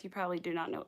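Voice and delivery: usual speaking voice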